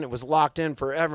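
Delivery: ordinary talk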